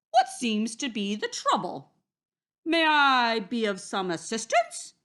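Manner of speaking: loud, courteous wolf offering help; hearty, mannerly wolf; polite Big Bad Wolf. A narrator's reading. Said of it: "said Big Bad Wolf politely".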